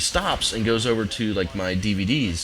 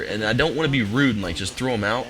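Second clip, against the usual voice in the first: normal talking voice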